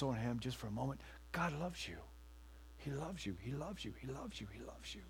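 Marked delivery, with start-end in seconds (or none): 1.3-5.0 each repeat softer until almost whispered; fading assurance. Real, not acted. Pastor assuring his flock, fading to a hush. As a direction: let it die away gently.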